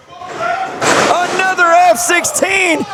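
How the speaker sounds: slow high drawn-out call; excited call. Real, not acted. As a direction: build to the winning moment. Match-winning move.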